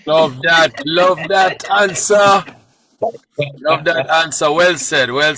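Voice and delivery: excited praise. Real, not acted. Host cheers the guest's answer.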